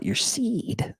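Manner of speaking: whispered question. Acted. Thomas whispering to the serpent about its seed. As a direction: whisper it like a secret.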